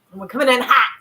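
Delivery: mock-triumphant loud call; playful hype shout. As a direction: punch it out like a hype man. Playful hype declaration, then apologizes.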